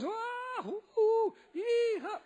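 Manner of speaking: wild high yee-ha; drunken whoops. Retold or imitated. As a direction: whoop like a tipsy old priest. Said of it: "And he goes".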